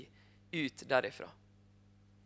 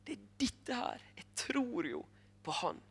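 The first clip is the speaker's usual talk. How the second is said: awed whisper; 5 semitones higher, 7 dB quieter than usual. Retold or imitated. Nikodemus realising, awed.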